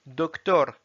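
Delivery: "Docteur" as Russian "doktor"; Russian pronunciation. Shown as a demonstration. Teacher reading words with learners, "Docteur" in Russian. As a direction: read "Docteur" in Russian, rolled R. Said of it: "s'entraîner à lire les mots".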